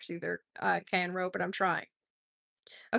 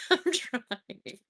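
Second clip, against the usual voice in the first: through a chuckle; laughing; 3 semitones higher than usual. Real, not acted. Host laughing at her power washer joke, giggling. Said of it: "laughs about own joke".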